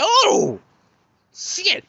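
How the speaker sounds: high, startled exclamation; shocked oh shit. Retold or imitated. Kid watching his brother get slapped, shocked high outburst. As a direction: gasp it out in shock. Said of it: "I said".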